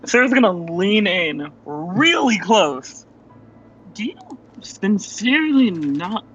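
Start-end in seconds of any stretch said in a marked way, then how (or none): 3.9-6.2 incredulous. Acted. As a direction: lean in close and question it word by word. Character leans in close, incredulous question.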